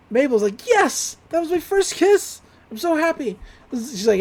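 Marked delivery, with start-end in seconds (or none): 0.7-3.4 high, squealing mock excitement; giddy delight. Girl celebrates her first kiss, acted giddy delight. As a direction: celebrate it way up high, like a thrilled kid.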